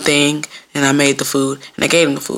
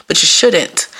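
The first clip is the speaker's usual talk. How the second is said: normal talking voice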